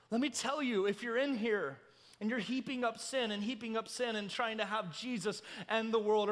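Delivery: ordinary delivery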